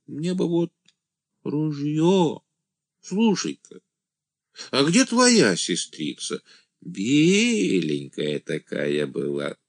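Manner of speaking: высокий, тягучий голос деревенского юродивого; тяни мечтательно, забираясь вверх; блаженный «ангел», мечтательно. A narrator's reading. (юродивый, мечтательно)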